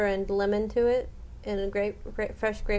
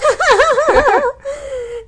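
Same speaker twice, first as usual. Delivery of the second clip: shrieking laugh; 12 dB louder than usual. Real, not acted. Laughing at own joke.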